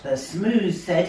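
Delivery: ordinary delivery, nothing marked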